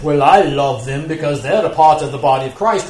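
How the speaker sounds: put-on lightly done mid-Atlantic accent, piously. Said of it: "piously say, you know, in your mid-Atlantic accent".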